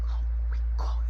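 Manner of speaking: hushed whisper. Retold or imitated. Brother whispering while hiding, hushed.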